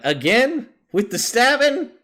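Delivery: incredulous, high and loud questioning; acted disbelief, voice jumping up loudly; Wildfire. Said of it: "or Wildfire's like".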